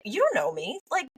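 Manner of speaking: high, incredulous mock retort; squeaky mock who-are-you; dismissive client. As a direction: scoff at a stranger's advice. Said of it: "you'd be like".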